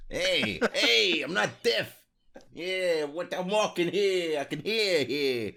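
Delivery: loud, high, slow Brooklyn tough guy; hammed-up Brooklyn accent, drawn out. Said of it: "You sound like you're from Brooklyn there".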